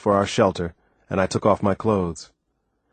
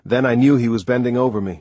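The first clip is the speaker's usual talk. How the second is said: ordinary delivery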